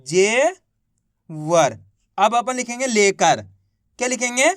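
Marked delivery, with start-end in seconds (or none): 0.0-1.8 slow drawn-out word. Shown as a demonstration. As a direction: stretch जेवर out very slowly. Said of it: "तो मिलके बन गया". (जेवर बहुत धीरे खींचकर)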